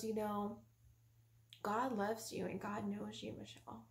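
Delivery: soft, tender reassurance; quiet and sincere, unhurried; calm husband. Retold or imitated. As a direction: reassure gently, letting the name fade. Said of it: "He was so calm".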